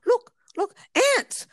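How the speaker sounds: high, urgent mock British accent. Client alerting the masseuse to ants, British accent. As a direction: imitate a British client pointing something out, high. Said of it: "sorry, the British accent".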